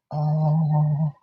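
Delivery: low, very soft pet coo; tender cooing. Real, not acted. Owner doting on their boy, tender cooing. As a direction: drop to a slow, loving murmur.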